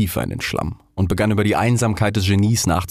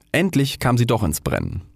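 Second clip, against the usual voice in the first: usual speaking voice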